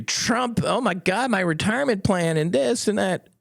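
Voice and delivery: a lightly voiced whining retail investor; mock panicked investor. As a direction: ham up the panicked small investor. Mocking panicked people on social media.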